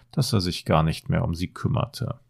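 plain reading voice

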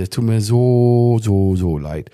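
slow, stretched-out retold apology; apologetic. Retelling his apology to the estate agent, exaggeratedly sorry. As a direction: draw out each "so" as if pleading.

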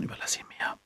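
unvoiced secret whisper. Invisible Harry whispering to Ron, a whisper. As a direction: hiss it so nobody hears you.

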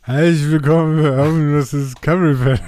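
mock drunken slur, loud; imitating slurred speech. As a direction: slur it like a tipsy host. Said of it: "Ihr habt's auch gehört".

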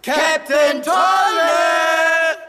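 lang gezogener, hoher Ruf nach oben; lauter Ruf. Acted. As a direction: den Papagei laut singend herbeirufen. Calling parrot loudly up shaft. (gedehnt und laut nach oben rufen)

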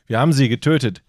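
loud, matter-of-fact report; firefighter voice. Retold or imitated. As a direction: announce it like a fireman. Firemen voiced in story.

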